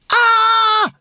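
very high, loud "aah"; frightened scream. A narrator's reading. Ninjas facing the dragon, high loud scream. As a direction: shriek it out at full height. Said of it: "riepen de ninja's".

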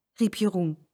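ordinary delivery

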